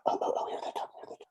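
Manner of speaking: hushed, eager whisper; excited whisper. Acted. Woman spotting the kids arrive, eager whisper. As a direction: whisper it like the prey is close. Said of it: "she says".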